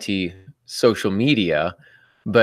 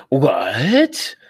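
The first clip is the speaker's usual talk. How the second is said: long-drawn "what?" swooping up; mock disbelief with a rising swoop; incredulous students; 3 semitones higher, 3 dB louder than usual. Students hearing his dad wrote the book, incredulous. Acted shocked reaction of students.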